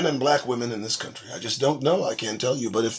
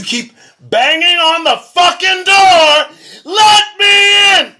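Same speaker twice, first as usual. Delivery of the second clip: higher, louder, slow mocking yell of let me in; mock begging shout; 11 semitones higher, 11 dB louder, slower than usual. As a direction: ham up someone pounding on a door. Mocking people banging on door.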